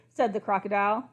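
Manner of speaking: plain reading voice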